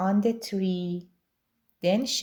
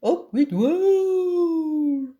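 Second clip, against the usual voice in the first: loud, high, drawn-out rallying yell; slow, roaring storybook chant; shouted war cry; 6 semitones higher, 6 dB louder, much slower than usual. A narrator's reading. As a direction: bellow it like a battle slogan. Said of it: "And they shouted".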